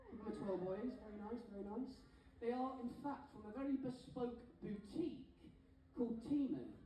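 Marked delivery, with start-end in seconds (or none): none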